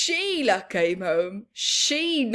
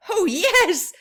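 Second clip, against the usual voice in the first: put-on delighted kid; 8 semitones higher, 8 dB louder than usual. Sheila delighted.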